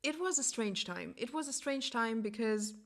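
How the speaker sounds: ordinary talk, nothing marked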